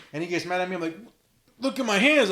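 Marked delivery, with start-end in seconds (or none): 1.6-2.3 higher, louder retold plea to dad; mild mock protest.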